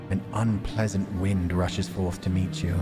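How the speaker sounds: ordinary talk, nothing marked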